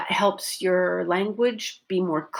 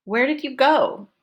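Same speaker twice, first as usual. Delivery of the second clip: higher pitch falling through a long "go"; stress on GO; 5 semitones higher than usual. Shown as a demonstration. Teacher models her focus verb, stress on "go". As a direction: land the focus on the final "go". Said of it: "Go, that verb is my".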